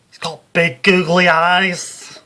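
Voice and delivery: loud, high, slow Liverpool medium, horrified; Psychic Sue, spooked. Acted. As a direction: drag out the reveal in horror. Sue's vision climaxes.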